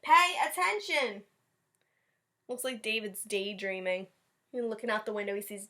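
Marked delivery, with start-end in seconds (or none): 0.0-1.2 slow, high, loud command; stern teacher. Acted. Teacher catching daydreaming David, slow and high. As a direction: drag it out impatiently.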